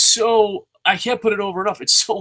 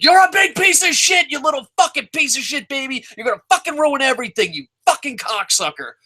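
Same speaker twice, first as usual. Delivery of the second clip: mock furious yelling; 8 semitones higher than usual. Bursting into the delivery room, screaming at the baby. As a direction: scream it down at a newborn like a madman.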